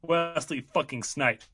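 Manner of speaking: big slow trailer title reveal; drawn-out epic movie title; announcer voice. Shown as a demonstration. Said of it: "Let me get my announcer voice".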